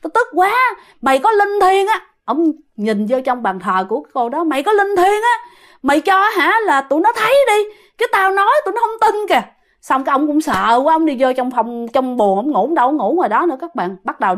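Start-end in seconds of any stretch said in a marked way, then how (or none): 0.0-2.0 high, exasperated yelling; frustrated shout. Retold or imitated. Old uncle yelling at the altar nobody believes him, high frustrated shout. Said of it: "ông mới la lên". 4.4-9.4 high, exasperated yelling; frustrated shout. Retold or imitated. Old uncle yelling at the altar nobody believes him, high frustrated shout. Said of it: "ông mới la lên".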